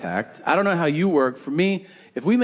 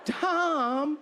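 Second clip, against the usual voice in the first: high, slow whiny protest; mock offended audience member; 13 semitones higher, 7 dB quieter, much slower than usual. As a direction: whine it like a scandalized fan.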